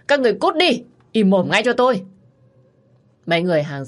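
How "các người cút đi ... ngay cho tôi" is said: much higher and louder, yelling them away; furious shout. Acted. Husband screaming at the gawking crowd, furious shout. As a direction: roar at the crowd to get out. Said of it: "Ông Đăng lúc này gào thét".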